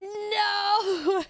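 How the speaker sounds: high wailing no; anguished shout. Real, not acted. Gamer shouting at bad loot, anguished no. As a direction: cry out in comic despair. Loot rage while gaming.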